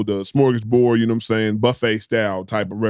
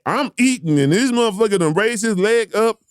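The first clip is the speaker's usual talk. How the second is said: indignant high rant; 8 semitones higher than usual. Real, not acted. Outraged retelling.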